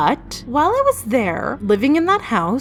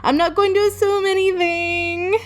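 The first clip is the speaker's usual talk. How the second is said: higher, slow, drawn-out voice of a wary inner sceptic; lightly voiced inner realist refusing to assume anything; cautious inner realist; 8 semitones higher than usual. Her inner realist talks her down, in a higher, slower voice. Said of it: "the realist part in my mind being like".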